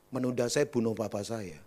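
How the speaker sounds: low monotone confession; flat, emotionless. Retold or imitated. Young man confessing to the retreat host, flat. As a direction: state it without any feeling. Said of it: "itu ngomong begitu datar loh".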